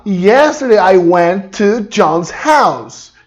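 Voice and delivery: slow, carefully spaced English; slow model sentence. Shown as a demonstration. Teacher modelling the correct sentence slowly. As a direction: lay out each word slowly for the class. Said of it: "O correto é falar".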